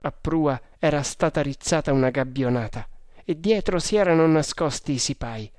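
ordinary delivery, nothing marked

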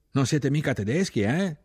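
loud high-pitched challenge; calling out. A narrator's reading. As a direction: call up to someone over engine noise. Calling up to soldiers on tank.

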